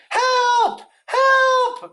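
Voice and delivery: very high panicked squeal; Piglet crying out. A narrator's reading. Piglet looks down at the heffalump, squealing in panic. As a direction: squeal for help, loud and terrified. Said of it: "cried Piglet".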